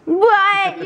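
loud shocked question; outraged what. Real, not acted. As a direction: disbelief burst. Shocked at his claim.

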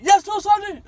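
high, loud barked answer; shouted reply. Retold or imitated. Recruit answering screaming drill sergeants, loud barked reply. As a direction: sound off to the sergeant at full volume.

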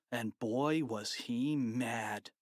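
ordinary delivery, nothing marked

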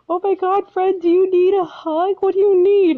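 high, caring gush imitating the worker; concerned barista. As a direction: gush with worry like a kind barista. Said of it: "And he's like".

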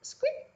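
very high sleepy squeak; yawning squeak. Acted. Piglets in the sty give a very high sleepy squeak. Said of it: "yawned the piglets".

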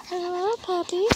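high, soft coo at the puppy. Real, not acted. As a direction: coo sweetly to a little dog. Talking to puppy.

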